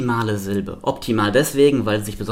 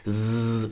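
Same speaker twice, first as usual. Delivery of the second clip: short voiced zzz; bee-like buzz; 4 dB quieter than usual. Shown as a demonstration. Teacher letting listeners feel the vibration, bee buzz. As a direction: buzz an S in the throat. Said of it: "vibriert es".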